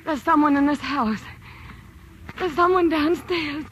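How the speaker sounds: ordinary talk, nothing marked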